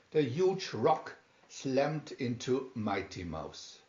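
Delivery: plain reading voice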